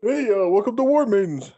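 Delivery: high put-on Brooklyn shopkeeper; thick New York shop greeting; Brooklyn accent. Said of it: "the thick Brooklyn accent".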